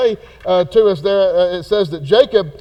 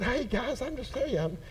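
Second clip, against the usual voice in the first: hushed, mock-innocent little brother; innocent Joseph; 3 semitones higher, 8 dB quieter, faster than usual. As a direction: play dumb, softly, as if just asking. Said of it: "trying to be so innocent".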